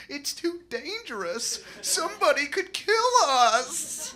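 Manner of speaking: high, slow, timid whine; mocking cowardly friends; scared friends. Friends refusing the Tijuana trip, high and timid. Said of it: "And they're like".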